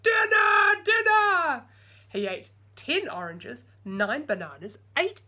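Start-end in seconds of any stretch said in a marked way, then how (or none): 0.0-1.6 put-on high, very loud giant-baby shout. Giant baby shouting for dinner, very loud. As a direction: roar for dinner. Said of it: "he cried".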